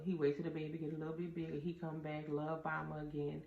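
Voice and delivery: normal talking voice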